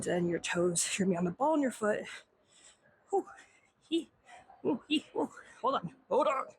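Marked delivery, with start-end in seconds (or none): 3.1-5.3 short effortful huffs and squeaks; real effort noises mid-stretch; straining grunts. Real, not acted. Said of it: "Why am I struggling?". 5.6-6.4 struggling instructor mid-stretch; straining. Real, not acted. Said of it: "Why am I struggling?".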